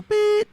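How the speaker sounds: high sharp imitated beep; loud high electronic beep; answering machine beep. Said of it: "listen to her messages like this".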